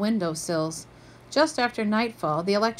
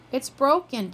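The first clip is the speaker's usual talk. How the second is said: wailing; 5 semitones higher, 4 dB louder than usual. A narrator's reading. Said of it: "Kirsten wailed".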